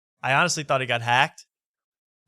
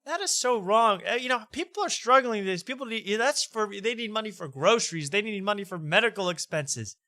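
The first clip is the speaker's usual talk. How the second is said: higher-pitched version of mom; imitating mom; 6 semitones higher than usual. Host retelling mom's objection to the fundraiser, imitating her in higher pitch. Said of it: "She goes".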